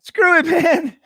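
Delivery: loud gleeful burst with a laugh; tossing caution aside, laughing; screw it excitement. Retold or imitated. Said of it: "He's just like".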